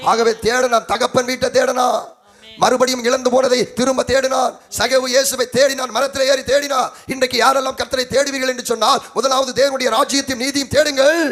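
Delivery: loud, high pulpit exhortation; full-force preaching. Acted. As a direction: urge the whole church at full voice.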